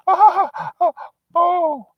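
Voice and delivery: very high falsetto delight; high-pitched mock girlfriend gasping at the view; gushing girlfriend. Wife voiced gushing over apartment.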